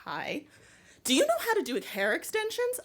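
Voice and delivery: sudden bright high-pitched Hi; abrasive greeting. Retold or imitated. Weird girl abruptly greets a lonely stranger, abrasive and high. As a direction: blurt it out abruptly, pitched well up. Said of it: "this was a very abrasive".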